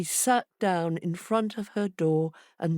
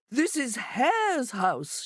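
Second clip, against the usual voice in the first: shout; 7 semitones higher, slower than usual. A narrator's reading. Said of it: "shouted Monkey".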